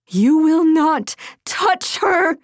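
high, slow, emphatic cry; restrained scream of fierce defiance; defiant scream. A narrator's reading. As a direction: cry out to protect a child. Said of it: "the woman screamed".

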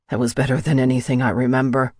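hurried, with a touch of breathlessness; panted. A narrator's reading. Kai catching his breath beside Rika, lightly panted. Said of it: "he panted".

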